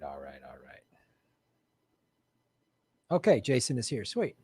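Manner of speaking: ordinary delivery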